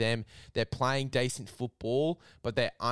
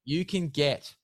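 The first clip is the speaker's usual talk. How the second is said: hyped ad read; 3 semitones higher, 4 dB louder than usual. Acted. Host plugging a discount code to listeners, mock-outraged ad read. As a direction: push the discount like it's a steal.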